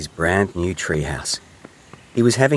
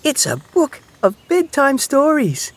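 very high, tiny mouse voice; little mouse; 3 dB louder than usual. A narrator's reading. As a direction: announce a gift in a high mouse squeak. Mouse continues presenting the gift.

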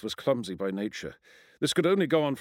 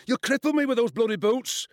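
high, loud wail of complaint; 12 semitones higher, 5 dB louder than usual. A narrator's reading. Schoolboy complains to Ian about his boots, wailing. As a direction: yelp it like an injured schoolboy. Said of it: "Gavin Hurst wailed".

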